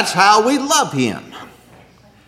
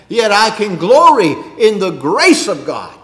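ordinary talk

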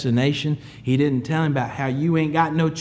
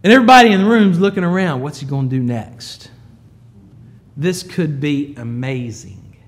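usual speaking voice

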